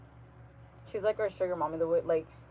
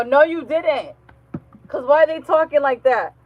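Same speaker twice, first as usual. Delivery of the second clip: loud, raised-pitch mock telling-off; scolding; 6 semitones higher, 8 dB louder than usual. Scolding a work mom about her kids, loudly. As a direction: re-enact firmly contradicting her, loud and higher.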